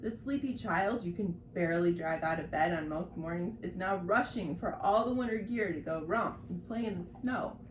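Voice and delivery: ordinary talk, nothing marked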